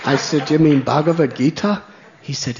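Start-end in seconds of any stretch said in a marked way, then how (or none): 0.5-1.8 "Bhagavad Gita" said carefully, correcting a wrong version; gently fix how he says "Bhagavad Gita"; correcting the pronunciation. Shown as a demonstration. Speaker to the man: "Bhagavad Gita" said the right way. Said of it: "Is that how you say it?".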